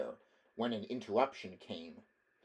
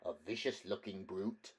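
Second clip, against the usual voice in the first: softer disdainful murmur; 2 semitones lower, 5 dB quieter than usual. Acted. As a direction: murmur it aside. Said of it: "murmured the young aristocrat".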